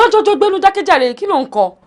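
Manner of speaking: high, loud cry to stop; loud protest. Acted. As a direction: shout him down to stop. Cuts off his bad singing.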